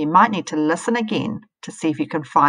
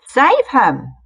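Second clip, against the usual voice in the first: higher, louder shriek; 7 semitones higher, 7 dB louder than usual. Acted. Spider crying out for the slug, loud shriek. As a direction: shriek out in alarm. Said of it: "shrieked a spider".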